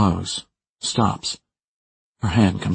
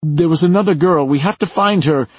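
high, urgent raised voice; yell; 9 semitones higher, 5 dB louder than usual. A narrator's reading. Someone yelling to find another girl, high urgent yell. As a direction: call out urgently, pitched up. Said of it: "someone else yells".

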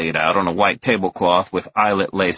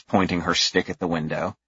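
usual speaking voice

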